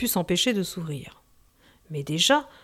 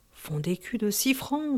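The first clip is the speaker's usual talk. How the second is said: softer, hushed murmur; 7 dB quieter than usual. A narrator's reading. Pierre Capucin awed by the silver coin, murmured. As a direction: say it under your breath in awe. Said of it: "murmura Pierre Capucin".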